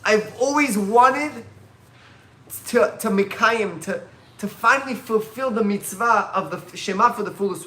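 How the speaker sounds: loud, high and very slow, with great intensity; a martyr's fervent, slow declaration; impassioned Rabbi Akiva. Acted. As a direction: draw out every word like a final testament. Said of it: "He said".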